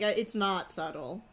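usual speaking voice

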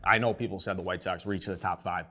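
ordinary talk, nothing marked

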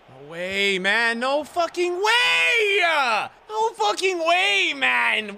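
from loud complaint up to a high, slow yell; raging streamer; building to a furious shout. Real, not acted. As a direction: explode in disbelief. Opponent scores, disbelief.

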